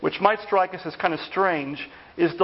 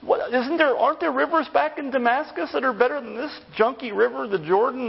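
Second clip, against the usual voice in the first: higher, mock-furious outburst; indignant rant; 6 semitones higher than usual. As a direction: play the offended commander scoffing at the river. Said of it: "He was furious".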